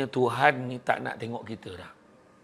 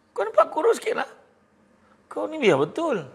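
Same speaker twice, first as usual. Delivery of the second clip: pitched way up, playful disbelief; high mock surprise; 12 semitones higher than usual. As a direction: tease him like you can't believe it. Second friend, surprised tease.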